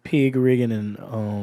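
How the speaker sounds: slow, low Georgia drawl; Georgia accent. Shown as a demonstration. Guest describing home to the host, Georgia accent. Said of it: "this is my Georgia accent".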